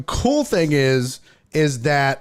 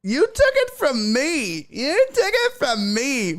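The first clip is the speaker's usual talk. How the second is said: much higher whiny mock voice, slowing at the end; whiny baby; 12 semitones higher than usual. Mocking the woman claiming the ball, high whiny baby voice. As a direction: whine it like a sulking child. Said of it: "what a whiny baby she sounds like".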